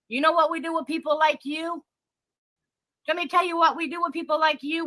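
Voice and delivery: high-pitched fast warning; heated threat. Real, not acted. Warning the rival about Alligator Bridge, heated threat. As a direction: threaten her, racing ahead.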